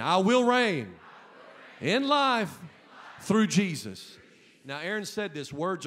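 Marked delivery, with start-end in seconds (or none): 0.0-4.2 loud all through, punch WILL; louder declaration. Real, not acted. Pastor leading the congregation to say it again, louder. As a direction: say it stronger this time. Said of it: "Say it again".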